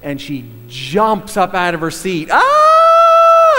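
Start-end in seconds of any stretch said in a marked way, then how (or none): 2.3-3.6 piercing high startled shriek, mimicking grandma; retold scream. Grandmother leaping from her seat at the film, shrieking. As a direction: let out a sudden sky-high scream. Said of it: "And she screams".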